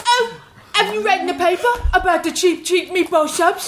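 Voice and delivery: high-pitched hammed-up Cockney; Mary Poppins British accent. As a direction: call it out like a cartoon British paperboy. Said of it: "moved on from Mary Poppins".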